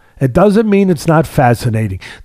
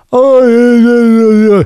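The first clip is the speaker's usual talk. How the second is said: high, loud mock wail of gibberish; babbling idiot; 8 semitones higher, 5 dB louder than usual. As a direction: howl it as slurred nonsense, mocking the critics. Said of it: "that's how they sound".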